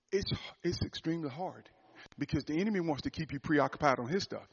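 normal talking voice

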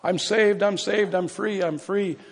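high, excited cries of freedom; joyful hollering. Retold or imitated. Preacher voicing a man running down a hall, hollering with joy. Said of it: "he ran down the hall hollering".

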